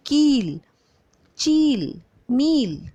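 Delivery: कील, चील, मील with the long ई leaned on and held; long ee stressed. Shown as a demonstration. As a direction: press each long ई firmly. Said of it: "जोर दे के बोली जाती है". (बड़ी ई पर ज़ोर देकर "कील, चील, मील")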